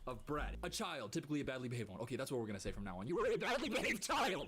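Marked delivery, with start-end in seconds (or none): 3.1-4.5 higher and louder, punching it out; loud mocking pronouncement; emphatic. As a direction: declare it loudly like a verdict. Reading definition at the creator.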